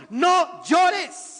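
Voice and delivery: high, loud, emphatic order; imperative command. Acted. Jesus to the weeping woman, loud imperative command. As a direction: impose it as a command. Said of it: "un imperativo, una imposición".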